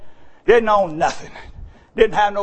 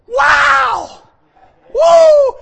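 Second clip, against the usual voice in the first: high whooping cry of joy; excited whoop; 10 dB louder than usual. Real, not acted. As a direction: let out a big joyful woo.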